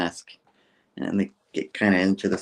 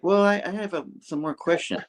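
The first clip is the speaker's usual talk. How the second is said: quoting Pete; 10 semitones higher than usual. Retold or imitated. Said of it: "And Pete's like".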